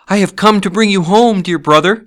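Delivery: put-on high, loud, excited child voice; little girl. A narrator's reading. Little girl come to bring her brother home, joyful child. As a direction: burst out with a little sister's joy. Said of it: "said the child".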